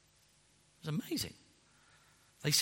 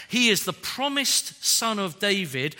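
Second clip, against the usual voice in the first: usual speaking voice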